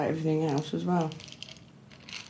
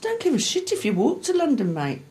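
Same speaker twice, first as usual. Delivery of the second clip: higher-pitched retold fury; retold angry dismissal; 8 semitones higher than usual. Woman recalling ordering her boyfriend out at night, angry. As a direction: show how you ordered him out at night. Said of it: "I said".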